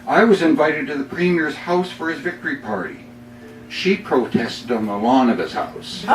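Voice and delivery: upbeat poem read with comic timing; humorous poem reading. Acted. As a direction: deliver the punchlines with a grin. Reads his humorous poem.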